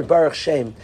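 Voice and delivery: ordinary talk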